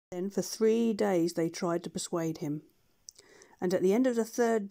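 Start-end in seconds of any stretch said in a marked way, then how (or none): none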